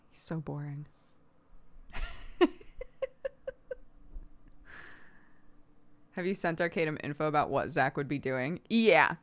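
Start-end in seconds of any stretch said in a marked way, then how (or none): 1.5-3.8 high unvoiced laugh; airy giggle; breathy laugh. Real, not acted. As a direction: let a soft breathy laugh out. Laughing after calling him boring.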